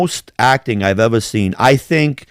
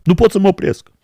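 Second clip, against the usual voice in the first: high, put-on foreign voice; mock Portuguese; 10 semitones higher, faster than usual. Joking with his cohost in a mock Portuguese voice. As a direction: toss off a mock Portuguese line.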